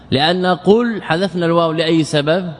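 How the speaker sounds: ordinary delivery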